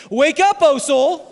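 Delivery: loud, high, drawn-out rallying cry; rousing call. Shown as a demonstration. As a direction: lead the congregation in a shouted refrain. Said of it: "somebody say it with me".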